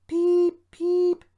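very high, loud engine peeps; two piercing high peeps; spluttering whistle. A narrator's reading. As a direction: imitate a little engine whistle in distress. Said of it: "he spluttered".

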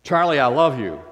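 loud, harsh bark at the dog. Shown as a demonstration. Pastor barking sharply at his puppy, loud and harsh. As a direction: snap it loudly so he cowers. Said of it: "I got into this other mood".

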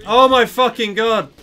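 slow, loud exasperated yell; frustrated outburst. Real, not acted. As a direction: let the frustration explode.

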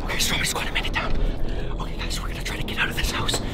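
tense hushed whisper. Acted. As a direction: whisper while sneaking. Sneaking out of the house.

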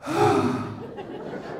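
long breathy mock sigh; exasperated sigh. Fed-up agent on the phone, exasperated sigh. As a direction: huff out a long exhale. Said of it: "you will hear [name] go".

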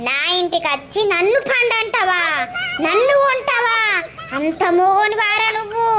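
a lightly voiced granny, scandalised; mock offended old woman; indignant old woman.

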